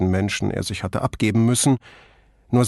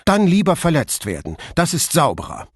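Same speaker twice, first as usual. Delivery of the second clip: higher, heated defiance; defiant retort; 5 semitones higher than usual. Acted. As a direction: throw the words back at him. Flavia defiant.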